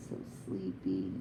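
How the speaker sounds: soothing. Real, not acted. Owner lulling bird to sleep.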